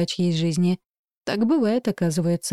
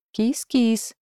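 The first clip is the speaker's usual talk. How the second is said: put-on high, dry, chittering old voice; 4 semitones higher than usual. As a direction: call the cat in a thin rattling old voice. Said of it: "позвал сухонький стрекочущий голос".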